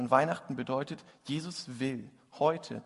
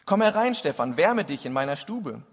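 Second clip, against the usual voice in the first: calling out; 2 semitones higher, 10 dB louder than usual. Acted. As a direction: call across the snowy street. Martin calls the old man in.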